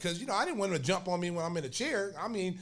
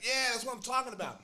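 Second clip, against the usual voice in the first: higher-pitched approving whoop; retold gleeful cheer; 7 semitones higher than usual. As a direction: imitate a coach thrilled by the scrap. Coach cheering the fight, slapping hand.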